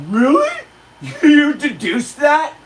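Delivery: high, slow, laughing disbelief; mocking laugh at a slow cop; laughing mockery. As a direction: laugh at him while asking. Mocking the cop's deduction.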